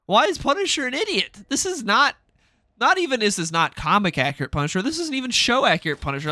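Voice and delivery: exasperated. Real, not acted. Exasperated rant about Punisher.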